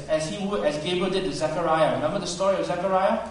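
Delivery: normal talking voice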